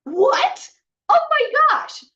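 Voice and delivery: high, loud burst of disbelief; high, loud shocked exclamation; stunned disbelief. Real, not acted. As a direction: gasp it out, floored by the invitation. Stunned at being invited.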